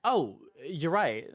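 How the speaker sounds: ordinary talk, nothing marked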